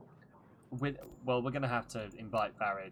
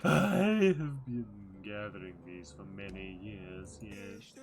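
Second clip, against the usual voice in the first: mystic merchant voice; slower than usual. Acted. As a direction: purr like a mysterious traveling merchant. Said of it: "this voice is, is tickling me".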